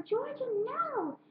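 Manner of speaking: high, slow, drawn-out yell of her name; retold yell. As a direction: call her back up the stairs, high and stretched. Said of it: "and then I yelled, and I screamed up at her".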